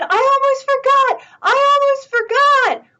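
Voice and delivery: high, loud urgent shout; loud high alarmed shout. Acted. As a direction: shout it loud enough to wake the animals. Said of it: "the farmer shouted".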